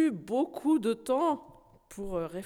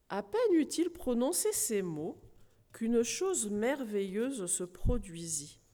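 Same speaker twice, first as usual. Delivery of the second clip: plain reading voice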